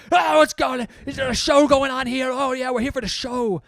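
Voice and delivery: high, loud mock holler; rowdy barging-in shout. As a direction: holler like rowdy men crashing a show. Acts out rowdy men barging in.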